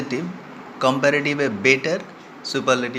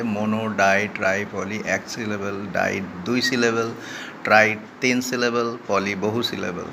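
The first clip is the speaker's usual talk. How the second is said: ordinary delivery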